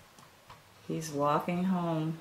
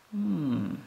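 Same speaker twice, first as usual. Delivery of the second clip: plain reading voice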